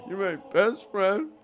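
high, loud, overflowing happiness; emotional groomsman. Acted. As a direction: gush to your best friend, pitch climbing. Groomsman moved on the wedding day.

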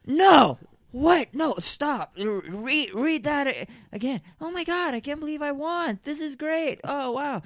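high, loud shrieking disbelief; shocked delight. Acted. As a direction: squeal with stunned joy at winning. Wins award, shocked and thrilled.

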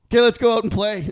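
put-on rally the kids past the awkwardness; brisk dad. Dad herding kids outside after outburst, brisk. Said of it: "My dad's like".

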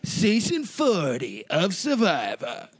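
slow, theatrical announcer build-up; epic show intro. Acted. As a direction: sell it like a blockbuster trailer. Chanted dramatic show intro.

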